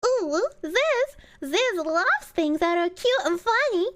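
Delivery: high, cutesy put-on squeal; ooh woo voice. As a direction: coo it out in a playful high voice. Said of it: "ooh, woo".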